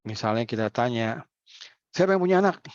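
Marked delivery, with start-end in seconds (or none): 1.9-2.6 calling out to crowd. Acted. Facilitator calls out to audience.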